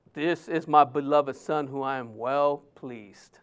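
low, soft voice of God; imagined heavenly voice. Acted. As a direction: let God speak quietly from above, dropped low. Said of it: "how did it sound? Was it loud?".

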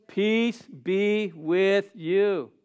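very slow, drawn-out blessing; slow solemn blessing. Acted. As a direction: stretch every word like a benediction. Preacher savours Jesus' words.

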